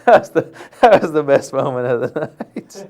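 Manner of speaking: chuckling through the words; can't hold back a laugh at his co-host. Real, not acted. Host laughing about [name]'s Fontana mix-up, real laughter. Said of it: "I'm just laughing 'cause".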